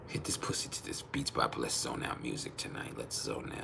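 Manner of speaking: breathy bedroom whisper, all air; seductive whisper. Pretend. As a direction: whisper it like a come-on in the dark. Seductive line suggested to say.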